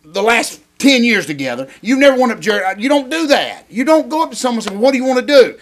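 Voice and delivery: worked-up protest, pitched high; emotional rant. Real, not acted. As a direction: scold a friend with real exasperation. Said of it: "show that much emotion".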